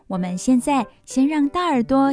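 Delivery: plain reading voice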